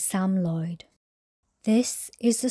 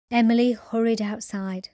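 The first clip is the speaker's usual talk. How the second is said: plain reading voice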